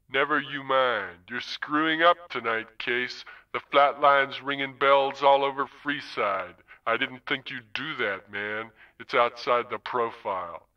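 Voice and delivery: Zone, easy and unhurried, a hint of menace; languid pimp. Wintermute as Zone needling Case about the flatline, lightly voiced languid pimp.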